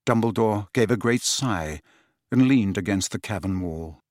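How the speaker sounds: plain reading voice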